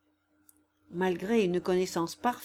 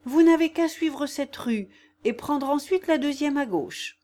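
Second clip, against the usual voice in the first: agitated; 6 semitones higher, 4 dB louder than usual. A narrator's reading. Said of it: "en proie à une agitation extraordinaire".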